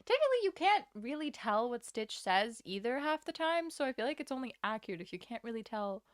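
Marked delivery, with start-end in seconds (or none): 0.0-3.7 a bit higher, louder alien tinge; lingering Stitch voice. Shown as a demonstration. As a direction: keep a trace of Stitch. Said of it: "I'm now stuck in this accent".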